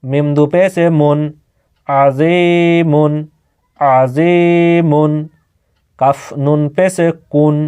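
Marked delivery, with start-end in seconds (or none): 1.9-5.1 আজিমুন stretched very slowly, nasal ending held; teacher's slow model pronunciation; slow drawn-out recitation. Shown as a demonstration. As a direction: draw out আজিমুন slowly for the pupils. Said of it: "একবার উচ্চারণ করে".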